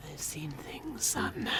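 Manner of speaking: put-on whispered old-timer line; mock grizzled whisper. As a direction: hush it like a weary veteran. Game-style old man line.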